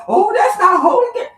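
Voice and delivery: high, loud mimicry of scandalized listeners; mock objector. Reader mocks listeners shocked that God cusses, mock objector. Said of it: "Y'all like".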